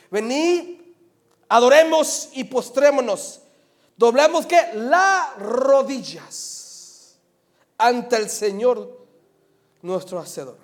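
slow, loud, emphatic verse reading; preaching scripture. Acted. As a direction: proclaim the verse, stretching key words. Reads scripture at preaching force.

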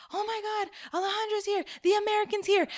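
high, excited mock cousins; high thrilled mock announcement. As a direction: announce her arrival with delight. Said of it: "my cousins would be like".

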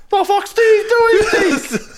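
high, slow, loud mockery; a hammed-up outraged fan; mocking fan. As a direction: drag it out mocking them. Mocking fans about Steve Hansen.

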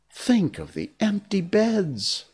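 high, slow appeal; imploring. A narrator's reading. Wendy asking the boys to pity the parents, imploring.

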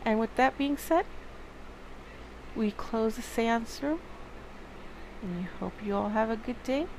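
soft, even outro read; podcast outro. Acted. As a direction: wrap up the show calmly.

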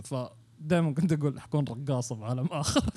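usual speaking voice

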